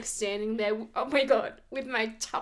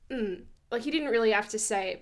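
usual speaking voice

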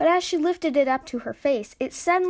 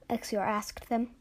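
ordinary delivery, nothing marked

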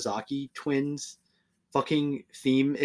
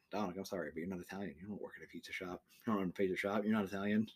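mock stern verdict; 6 semitones lower, 13 dB quieter, much faster than usual.

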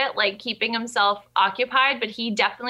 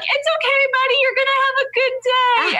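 very high, big-smile mom voice; forced cheerfulness; 4 dB louder than usual. Retold or imitated. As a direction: overdo the cheer to reassure a little boy. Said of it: "I'm just smiling so big that my face hurt".

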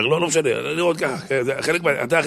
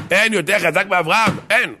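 high, raised exclamation; ringing, insistent cry; emphatic proclamation; 8 semitones higher than usual. Acted. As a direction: declare it with full conviction. Emphatic repeat of the answer.

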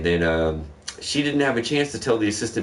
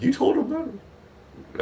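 much higher, slow, stretched-out disbelief; shocked high disbelief; 14 semitones higher, 3 dB quieter, much slower than usual. Retold or imitated. Assistant manager reacts to his story, drawn-out high disbelief. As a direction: gasp it out like the boss can't believe it. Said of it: "she's like".

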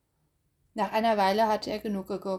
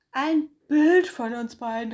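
loud, joyful bear exclaiming; happy cry; 5 semitones higher, 8 dB louder than usual. Acted. Bär sees them both in the mirror, loud and happy. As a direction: call it out full of happiness. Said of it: "rief Bär voller Glück".

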